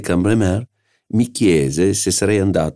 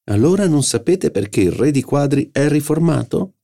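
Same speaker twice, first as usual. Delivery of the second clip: higher, pressing repeat of the riddle; insistent; 10 semitones higher than usual. A narrator's reading. Cottard insisting on his riddle, eager higher repeat. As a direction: push the joke again, eager. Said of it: "riprese Cottard".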